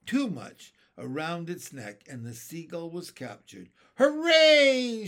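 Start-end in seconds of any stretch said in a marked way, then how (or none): 4.0-5.1 high, loud jubilant shout; long whooping cry of triumph; shouted cheer. A narrator's reading. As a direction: cheer it out at full voice. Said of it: "shouted the old green grasshopper".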